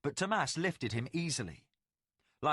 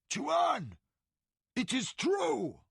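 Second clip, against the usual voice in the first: much higher, amazed shout; astonished cry; 12 semitones higher, much slower than usual. Acted. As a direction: blurt it out in disbelief. Warrior discovers sleepers alive.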